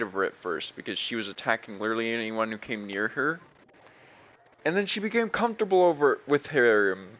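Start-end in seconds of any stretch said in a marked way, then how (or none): none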